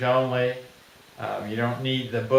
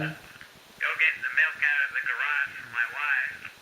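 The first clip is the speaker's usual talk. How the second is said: softer, tinny recording from a small speaker; played back from the device; 6 dB quieter than usual. Shown as a demonstration. Inventor playing back his reminder to himself, as a tinny recording. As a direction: sound like a voice memo on a little gadget. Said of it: "So I hit the playback button".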